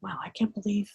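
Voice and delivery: whispered under the breath; internal whisper. Shown as a demonstration. Coach showing an inner reaction, whispered to herself. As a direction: breathe it as a private thought. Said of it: "this is internal".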